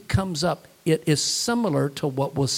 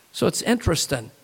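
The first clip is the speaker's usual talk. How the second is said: normal talking voice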